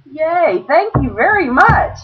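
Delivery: high, singsong thank-you; mock delight. Player thanks casino man for cards, acted delight.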